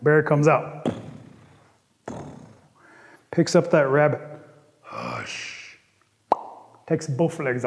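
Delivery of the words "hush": very low, very soft "hush"; a huge bear's low hush. Acted. Bear silences Rabbit.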